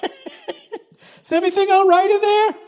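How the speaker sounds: high, slow, concerned falsetto through the door; worried voice outside. Acted. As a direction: call in gently, high and worried. Someone outside hears the shout.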